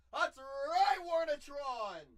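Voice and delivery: loud, high hype yell; excited shout. Real, not acted. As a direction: hype it up, slowly.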